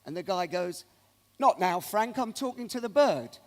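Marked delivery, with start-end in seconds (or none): 1.4-3.4 loud, dismissive punchline; loud brush-off. Acted. Cockney guy to [name] in front of his date, loud brush-off. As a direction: bellow it to brush off an interruption.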